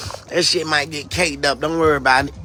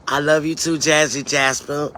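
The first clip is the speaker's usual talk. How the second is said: usual speaking voice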